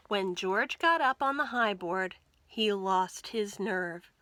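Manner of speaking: ordinary delivery, nothing marked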